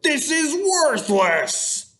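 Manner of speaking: high, slow scornful Viking; gruff barbarian scorn. Acted. Ronan scorns the worthless book, slow and mocking. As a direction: drag out the disgust at the book.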